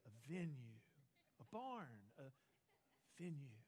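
low, soft mocking repetition of venue. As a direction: drag out the word like it's silly. Mocking wedding venue craze.

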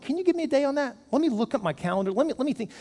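acted hesitation, gently putting someone off; pondering stall. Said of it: "I'm gonna stop and ponder".

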